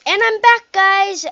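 loud, high hello to viewers; cheerful streamer welcome-back call; bright greeting. Real, not acted. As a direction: bounce back in with energy. Greeting viewers after break.

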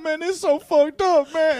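crying. Retold or imitated. Suspect breaking down to police, mock crying. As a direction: imitate a suspect breaking into tears. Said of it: "He just started crying".

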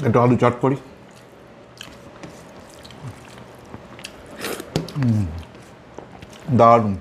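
chewing between words; eating rice by hand; mouth full of food. Real, not acted. Seen 2: Just put food in mouth at -1s; jaw chewing while murmuring.

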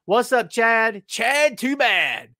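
slow, stretched name call; hammed-up slow announcement; drawn-out greeting. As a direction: stretch out Chad Too Bad like a ring call.

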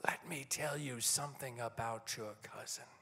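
conspiratorial, hushed confiding; confiding a secret. Retold or imitated. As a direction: lean in and share a secret. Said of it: "in a very conspiratorial voice".

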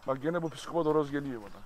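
ordinary delivery

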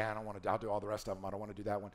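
low, soft, grudging mumble; reluctant grumble. Retold or imitated. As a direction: mumble it like you're dodging a chore. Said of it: "most people are like".

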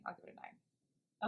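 whispered verdict. Real, not acted. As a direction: breathe the score out. Final verdict given softly.

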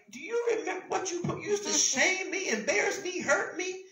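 high-pitched, mildly indignant questioning; indignant reproach. Acted. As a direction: act out a wronged person's reproach. Acting out the wronged person's reply.

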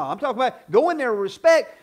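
normal talking voice

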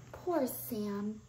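ordinary delivery

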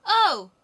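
sudden loud high yelp of pain; pained cry. Acted. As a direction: yelp as your ear is bitten. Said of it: "cried the woman".

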